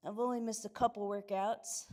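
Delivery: ordinary delivery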